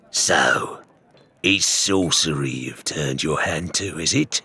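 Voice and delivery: low, hard challenge; a touch of menace in the question; demanding. A narrator's reading. Grey Marrow confronting Ramsay over sorcery, low demanding. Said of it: "he demanded".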